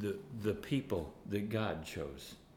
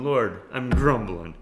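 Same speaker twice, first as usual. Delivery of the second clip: laughing confession; 3 semitones higher, 9 dB louder than usual. Retold or imitated. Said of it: "I'm going".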